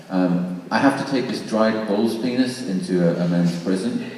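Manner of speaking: ordinary talk, nothing marked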